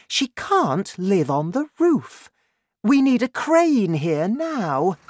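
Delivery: high, urgent woman's voice; high-voiced wife demanding action; insistent mother. A narrator's reading. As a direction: insist on it, pitch well up.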